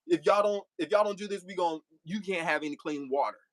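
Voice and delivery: ordinary talk, nothing marked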